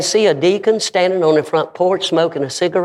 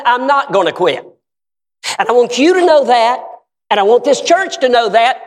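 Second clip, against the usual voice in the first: defiant deacon; 9 semitones higher, 3 dB louder than usual. Retold or imitated. Deacon defies preacher before church.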